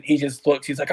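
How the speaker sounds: ordinary talk, nothing marked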